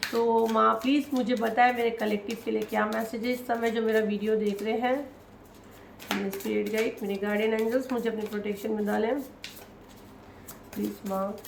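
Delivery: hushed, devout invocation to Maa; quiet prayer. Acted. Reader calls on Maa Kali and her guides in a soft prayer. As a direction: pray softly, as if asking for protection.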